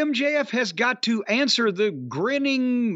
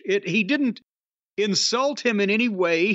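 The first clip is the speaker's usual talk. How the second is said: usual speaking voice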